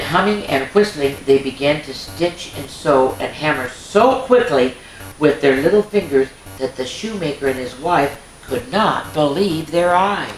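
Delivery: ordinary delivery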